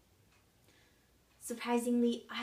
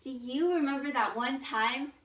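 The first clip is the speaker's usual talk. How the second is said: louder, lifted pitch, imitating a tiresome asker; mimicked question; 4 semitones higher, 7 dB louder than usual. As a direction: mimic an annoying question, loud and higher. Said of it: "I hate when people ask me".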